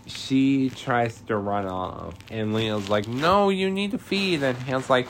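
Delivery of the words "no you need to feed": high-pitched imitation of urging; mock insistence. Recapper voices Leo urging Hannah to feed, high mock insistence. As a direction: insist in a raised, squeaky voice.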